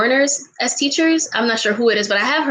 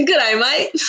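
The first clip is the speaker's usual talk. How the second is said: third Australian try; 3 semitones higher than usual. Shown as a demonstration. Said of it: "I can't do it".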